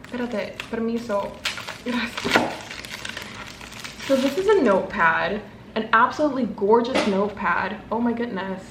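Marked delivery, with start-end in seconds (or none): none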